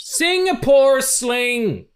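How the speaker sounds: high, loud, drawn-out exclamation; belted quote. Retold or imitated. Loving a favourite gag, belting the line long and high. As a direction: belt the line out, stretching every sound.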